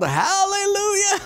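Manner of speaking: high, loud yell breaking into a laugh; yelled hallelujah. Retold or imitated. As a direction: shout it to stop the horse in time. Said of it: "So he yelled".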